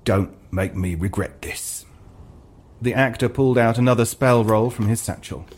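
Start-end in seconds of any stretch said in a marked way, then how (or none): 0.0-1.9 low, soft, suspicious grumble; gruff shopkeeper. Acted. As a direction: eye him with suspicion.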